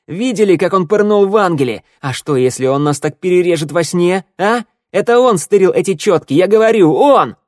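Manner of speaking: high, loud agitated tirade; yelling. A narrator's reading. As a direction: whip up the crowd, building to a yell. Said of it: "орал Ворона". (орать, обвиняя)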